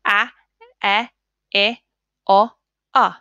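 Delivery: "A, e, I, o, a" each said short and clipped; short vowel sounds. Shown as a demonstration. Teacher drilling pupils on short vowels, clipped. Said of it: "say the short vowel sound".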